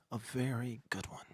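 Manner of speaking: soft unvoiced whisper. Host promising the viewers a good one, whispering. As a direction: hush it like a teaser. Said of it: "You like my whisper?".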